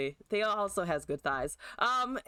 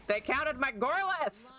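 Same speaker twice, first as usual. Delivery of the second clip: raised-pitch disbelief; aggrieved, higher-pitched protest; indignant complaint; 7 semitones higher than usual. Real, not acted. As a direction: protest it, voice climbing. Upset at losing his card.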